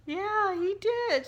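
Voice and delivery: awed friend. Retold or imitated. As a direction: friend agrees the late husband sent the song, high and slow in awe.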